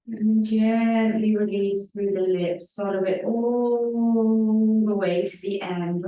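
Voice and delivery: drawn-out breath cue. Real, not acted. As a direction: draw the words out through the whole breath.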